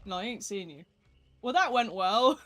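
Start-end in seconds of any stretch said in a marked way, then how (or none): 1.4-2.5 laughing. Real, not acted. Laughs after bad game.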